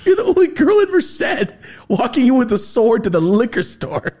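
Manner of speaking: high, loud, laughing exclamation; laughing outburst. Real, not acted. Mock outburst at the friend.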